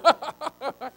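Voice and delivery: high burst of giggling laughter; own laugh. Retold or imitated. Telling Ellie he doesn't know, then giggling. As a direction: laugh at yourself helplessly.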